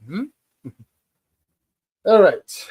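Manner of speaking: normal talking voice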